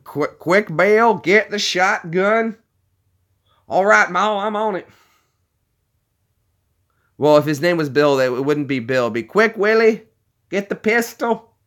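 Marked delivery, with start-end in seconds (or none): 0.0-4.9 high-pitched mock country folk in a panic; hammed-up rustic alarm; urgent skit characters. Acted Ma and Bill shotgun skit. 9.2-11.5 high-pitched mock call to arms; urgent skit character. Redoes the skit with new words.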